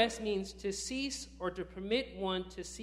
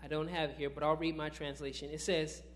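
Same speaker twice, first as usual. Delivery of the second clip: usual speaking voice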